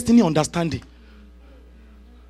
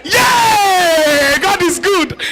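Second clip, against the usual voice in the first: mock cheer; 9 semitones higher, much slower than usual. Real, not acted. Preacher cheers about being paid while sick, high whoop. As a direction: cheer like you've won.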